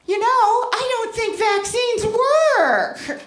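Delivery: high, slow, sing-song mock of the daughter-in-law; dumb daughter-in-law voice. Mocking the daughter-in-law at a beach day, high singsong. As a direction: play her as a vapid singsong know-it-all.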